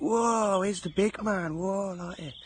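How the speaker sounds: high, loud whooping cheer; mock cheering. Band members hyping up a fan doing press-ups, loud mock cheer. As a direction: cheer him on like a rowdy crowd. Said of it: "And they went".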